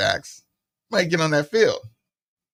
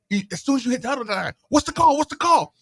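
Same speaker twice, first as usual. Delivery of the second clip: intense urgency; 12 semitones higher, 4 dB louder than usual. Retold or imitated. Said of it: "you could just hear his voice and his intensity".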